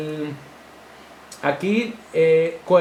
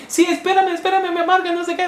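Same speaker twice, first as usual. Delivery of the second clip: much higher, louder pleading wife; 13 semitones higher, 4 dB louder, faster than usual. Retold or imitated. Wife carrying the children, begging her husband to wait, high and pleading. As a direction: beg him to wait while hauling the kids.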